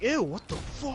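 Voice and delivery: high, squeaky disgusted ew. Retold or imitated. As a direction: recoil in mock disgust. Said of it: "I'm just like".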